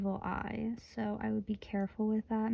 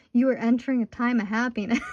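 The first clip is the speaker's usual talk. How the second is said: louder, words breaking into laughter; laughing through it; 3 semitones higher, 7 dB louder, faster than usual. Real, not acted. Tarot reader to client, bursting into laughter. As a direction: read it and crack up.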